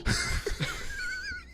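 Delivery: squealing mock laugh; high cackle. Cruel laugh after kid's question.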